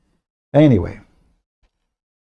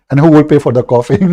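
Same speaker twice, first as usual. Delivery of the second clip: asking through a laugh, louder and fast; laughing question; 6 dB louder, faster than usual. Real, not acted. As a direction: laugh out the question about who pays. Laughing at coffee bill.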